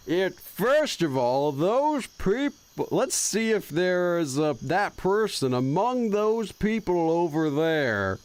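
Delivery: very slow, stretched Texas drawl; bad fake Texas accent. Streamer trying out a fake Texas accent, drawn-out. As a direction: stretch out a fake Texan twang. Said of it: "gonna do the bad fake Texas accent".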